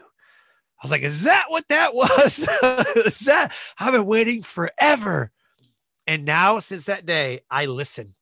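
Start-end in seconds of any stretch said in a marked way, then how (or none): 1.0-2.5 high, loud and slow amazed question; amazed, high-pitched disbelief; surprised. Retold or imitated. Surprised reply to pastor. 2.5-5.3 laughing. Real, not acted. Laughing after the pastor's remark.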